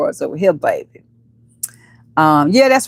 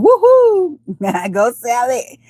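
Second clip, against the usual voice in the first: high-pitched whoop; excited cheer; 5 semitones higher, much slower than usual. Real, not acted. Host cheering Sally's jump to number one, excited. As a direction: let out a high, celebratory whoop.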